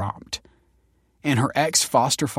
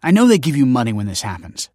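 quick, raised, accusing tone; snapping; 4 semitones higher, 6 dB louder than usual. A narrator's reading. Kelsey scolding her ex-foster father, snapping. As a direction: snap questions and accusations at him. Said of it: "snapped Kelsey".

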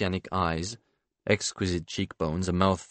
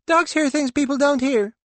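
put-on very high squeaky little boy, louder; mysterious child; 5 dB louder than usual. A narrator's reading. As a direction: squeak it out like a small boy with a secret. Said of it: "the child says mysteriously".

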